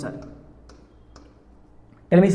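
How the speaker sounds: ordinary delivery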